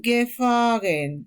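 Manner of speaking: gefahren, slow, stress on fa not ge; German word stress. Shown as a demonstration. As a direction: draw out gefahren with the weight on fa. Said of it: "Pero acá dice".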